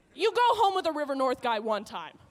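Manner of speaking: ordinary talk, nothing marked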